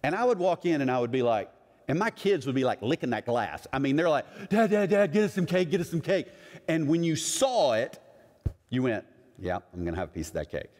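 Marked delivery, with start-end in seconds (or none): 4.5-6.3 higher, eager imitation of pleading kids; excited begging. Kids at the cake case begging Dad, excited. 9.4-10.7 quick, low, soft aside to oneself; low murmured resolve. Retold or imitated. Customer who saw the cakes, imitated quiet decision. Giving in at the cake case.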